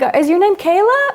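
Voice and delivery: high, sing-song question; coaxing a child. Retold or imitated. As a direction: pitch it way up for a shy child.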